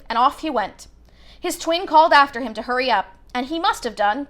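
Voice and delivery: ordinary delivery, nothing marked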